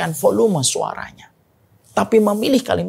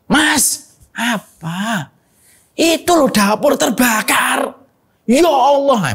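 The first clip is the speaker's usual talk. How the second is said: wife calling out in alarm, higher and louder; mock panicked wife; 6 semitones higher, 3 dB louder, much slower than usual. Preacher acts a wife yelling to her husband about a kitchen fire, mock alarm. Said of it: "baru boleh teriak".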